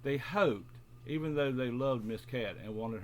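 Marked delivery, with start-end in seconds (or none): none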